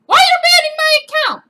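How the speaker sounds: high, fast, loud shriek; hammed-up screaming meltdown; outraged scream. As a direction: scream the question at the agent. Acted outburst at the support agent.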